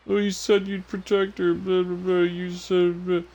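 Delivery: high-pitched mock whine; mocking complaint. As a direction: parrot the complaint in a silly high voice. Mocking a character's childish complaint.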